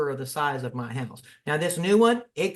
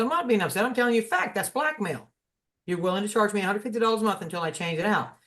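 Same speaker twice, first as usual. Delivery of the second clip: retold indignant retort; 6 semitones higher, faster than usual. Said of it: "I said".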